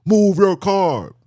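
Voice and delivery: slow, loud command; police order. Retold or imitated. Police officer ordering him to move, slow and loud. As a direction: lay down the order like a cop. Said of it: "I even had police".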